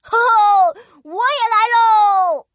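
loud high excited yell; excited shout. Acted. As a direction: shout with joy as you join. Joins with a cry.

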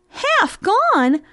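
high, astonished mouse voice; incredulous echo. Acted. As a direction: repeat it high, in disbelief. Mouse echoes name in disbelief.

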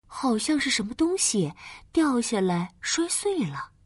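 gentle bedtime story narration; fireside storytelling. A narrator's reading. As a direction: read it like a cozy bedtime tale. (温馨的睡前故事讲述)

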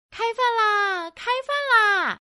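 loud, high, drawn-out dinner call. Acted. Storyteller calling the children to eat, loud singsong call. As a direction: call everyone in, stretching the final syllable.

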